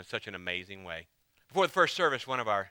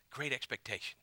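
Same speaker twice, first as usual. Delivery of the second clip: soft repeat; 8 dB quieter than usual. Acted. Repeated softly.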